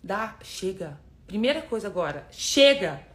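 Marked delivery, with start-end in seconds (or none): 2.3-3.0 battle cry shout. Shown as a demonstration. Coach urging viewers to say enough, a loud battle cry. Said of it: "Dá um grito de guerra aí".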